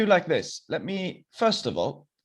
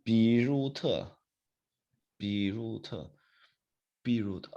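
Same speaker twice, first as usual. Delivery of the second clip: slow sounding-out; 3 dB quieter than usual. Pretend. Sounding out student's name slowly.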